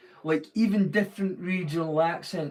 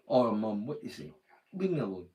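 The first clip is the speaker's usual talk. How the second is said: lower-pitched, offhand Doric Scots; broad Doric to mum; 4 semitones lower, 4 dB quieter than usual. Shown as a demonstration. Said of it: "the way I would talk to".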